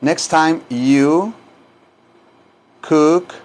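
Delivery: slow, broken into separate pieces; slow repeat-after-me model. Shown as a demonstration. Teacher models the phrase for students, slow, piece by piece. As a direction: pause between the pieces, lifting each ending.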